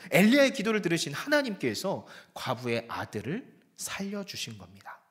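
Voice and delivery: usual speaking voice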